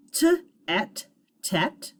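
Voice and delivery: "t", high "et", then joined "tet"; sounding out. Shown as a demonstration. Teacher and pupils say the first syllable, sounding out. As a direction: break it into "t" and "et", then blend "tet". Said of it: "so let's say it".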